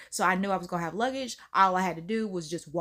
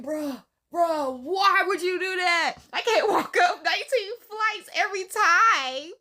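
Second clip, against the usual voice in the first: much higher, louder complaining at her sister; exasperated; 9 semitones higher, 4 dB louder than usual. Retold or imitated. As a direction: let the outrage build. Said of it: "I'm just like".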